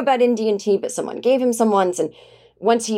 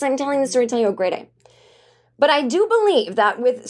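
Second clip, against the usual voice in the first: ordinary delivery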